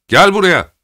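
high, loud command; shouted order. A narrator's reading. Sergeant calling Schemmer over, loud command. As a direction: bark him over, loud.

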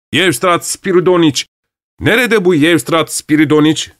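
lightly voiced searching calls; calling out. A narrator's reading. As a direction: call his name around the club. Voices all over club call him.

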